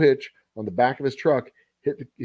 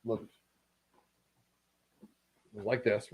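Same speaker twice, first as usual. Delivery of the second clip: ordinary talk, nothing marked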